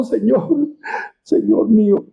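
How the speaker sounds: mildly acted weeping appeal; acted tearful plea. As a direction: plead as if in tears, understated.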